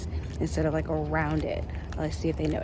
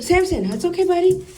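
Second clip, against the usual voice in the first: lifted, loud reassurance to a nervous dog; high coaxing dog voice; 9 semitones higher, 7 dB louder than usual. Real, not acted. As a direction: coax Samson up high and loud.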